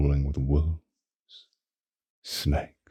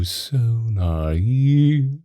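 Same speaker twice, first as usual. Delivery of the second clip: normal talking voice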